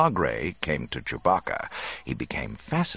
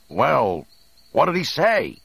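loud, raised demand for news; high, urgent call; concerned shout; 10 semitones higher, 6 dB louder than usual. A narrator's reading. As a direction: shout the question across, worried. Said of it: "Han shouted with some concern".

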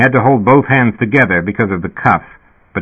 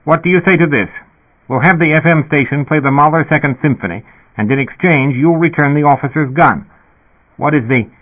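higher-pitched bargaining dispatcher; lightly voiced intercom official, pitched up to negotiate; sputtering reasonably; 6 semitones higher than usual. A narrator's reading. Dispatcher offering music for the gun, sputtering reasonably. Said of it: "the speaker sputtered reasonably".

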